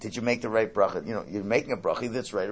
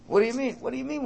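incredulous; 11 semitones higher, faster than usual. Real, not acted. Rabbi challenges the sure person.

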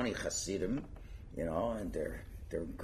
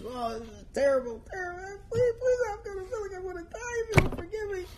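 mock bawling plea; faster than usual. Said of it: "he starts bawling his eyes out".

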